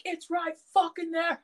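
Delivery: high, fed-up retort, retold; retold flip-out. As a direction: act out losing patience. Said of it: "I fucking flip out".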